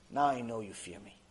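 low, soft, measured voice; hushed divine pronouncement. Acted. Hashem speaking to Avraham, hushed pronouncement. Hashem answers the angels' plea.

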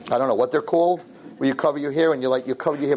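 normal talking voice

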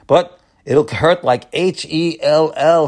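slow, letter by letter H E L L; spelled-out hell. Retold or imitated. Rabbi warning his listeners, slowly spelling the word. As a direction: spell the curse out slowly, half joking.

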